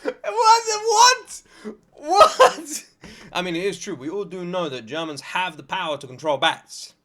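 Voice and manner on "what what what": high, loud disbelieving cries; stunned what. Real, not acted. Listener hearing the bat story, loud disbelief. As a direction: repeat it in shock, louder.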